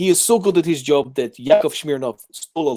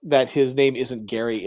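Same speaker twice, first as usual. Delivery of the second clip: ordinary talk, nothing marked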